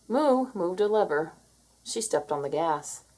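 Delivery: usual speaking voice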